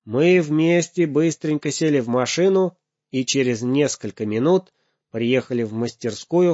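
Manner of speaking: ordinary delivery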